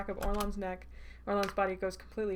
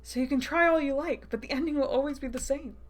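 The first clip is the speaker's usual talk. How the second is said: higher, louder lilting villain taunt; 6 semitones higher, 6 dB louder than usual. Acted. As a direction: dismiss their hopes with theatrical relish.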